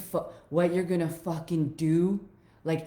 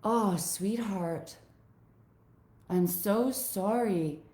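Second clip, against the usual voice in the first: tender; 4 dB quieter than usual. Retold or imitated. Speaking to her frightened inner child, softly tender. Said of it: "I kept saying to myself".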